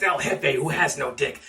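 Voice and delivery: normal talking voice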